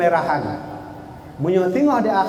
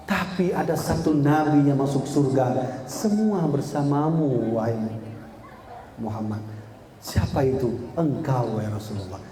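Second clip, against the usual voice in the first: hushed reveal; 6 semitones lower, 5 dB quieter than usual. Acted. Musa's final answer, fading.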